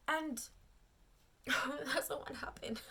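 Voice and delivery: said through a laugh, slowed; laughing at her own misfortune. Real, not acted. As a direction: giggle at the irony.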